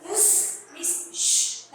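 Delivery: whispered shh, slow; hushing. Shown as a demonstration. Teacher showing students how to hush, whispered shh. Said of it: "like this, okay?".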